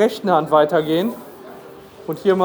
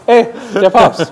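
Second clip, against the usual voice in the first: laughing; 6 dB louder, slower than usual. Real, not acted. Lacht über Papst-Avatar.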